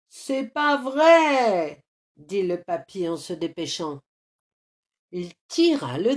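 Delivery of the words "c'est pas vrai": loud, drawn-out groan of disbelief; fed-up old man, loud and higher-pitched; exasperated outcry. A narrator's reading. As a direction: wail it in exasperation, slowly. Old man exasperated at stuck boy.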